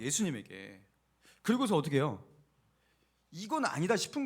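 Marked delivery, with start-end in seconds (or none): 0.0-0.8 예수님에게 repeated much lower and softer; soft echo. Shown as a demonstration. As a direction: drop low and quiet on 예수님에게. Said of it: "예수님에게 나아갔어요". (예수님에게를 낮고 조용하게)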